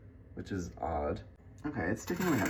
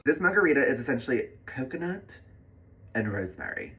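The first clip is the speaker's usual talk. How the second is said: ordinary talk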